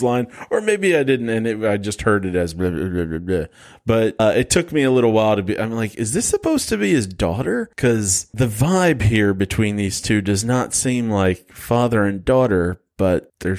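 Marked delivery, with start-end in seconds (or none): 5.9-7.7 much higher, bemused question; wondering aloud, puzzled. Retold or imitated. Watching the movie confused, higher and puzzled.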